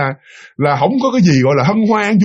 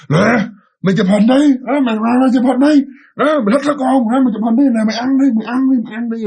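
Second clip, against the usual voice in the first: higher, strange spirit voice jeering; possessed voice; 7 semitones higher, faster than usual. Retold or imitated. Spirit speaks through husband, taunting, high and strange. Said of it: "cái giọng nói thì là của ai đó".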